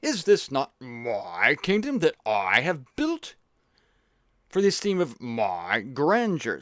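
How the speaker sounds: slow, pompous king, stretching every MY; Nebuchadnezzar. Acted. King to wife about slippers and kingdom, pompous. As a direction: draw it out grandly with swooping questions. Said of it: "when his wife, uh, brought his slippers".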